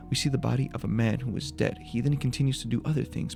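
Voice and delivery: ordinary talk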